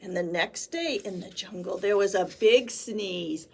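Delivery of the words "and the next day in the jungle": ordinary delivery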